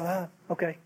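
soft, resigned murmur of surrender; meekly giving in. Acted. Blind man obeying the crowd's shut up, meekly. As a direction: back down quietly, as if told off. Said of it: "he just said".

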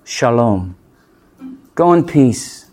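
low, softened pious blessing; hushed, sanctimonious send-off; mock spiritual. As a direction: mimic a serenely spiritual well-wisher. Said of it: "real spiritual like".

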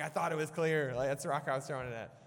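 high, loud mock outburst; exasperated yelling. Kid insists to his sister he was clear, exasperated yell. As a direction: shout back like an indignant arguing kid. Acted sibling quarrel, shouting back.

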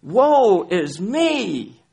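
slow, high, loud lament on woe; groaning cry of woe is me. Shown as a demonstration. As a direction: wail the word woe slowly. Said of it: "Instead of ... "Wow is me".

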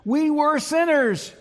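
high, loud and slow, crying out to the congregation; preaching cry. Real, not acted. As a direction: raise the voice and stretch it out. Preacher emphasizing humanity's sin.

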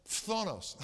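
"Phthonos" with a spitting Greek onset; "Phthonos", amused, its first cluster spat out; tricky Greek pronunciation. Shown as a demonstration. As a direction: push out the start of "Phthonos" as if about to spit. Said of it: "very difficult to pronounce".

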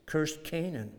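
normal talking voice

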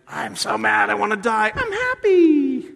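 sulky prophet suddenly cheered, voiced high and silly; mock whiny Jonah. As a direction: ham up a sulky child flipping moods. Mocking Jonah's sudden mood swing.

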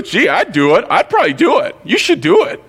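high-pitched, swooping servant voice; coaxing servants. Acted. Servants urging their master to obey, high coaxing voice. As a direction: coax the boss, pitch leaping way up.

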